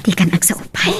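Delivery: gentle unvoiced whisper; whispered comfort to a sick son; soft whisper. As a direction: hush him softly.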